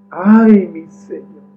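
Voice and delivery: loud, slow, high "Ay mi Señor"; alarmed cry. Acted. Servant seeing the army around the city, alarmed cry. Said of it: "exclamó el criado".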